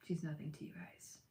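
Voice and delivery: unvoiced, confiding whisper; whispered aside. Real, not acted. Whispering to Jessica that Marlo is nothing. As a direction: drop to a whisper for the jab.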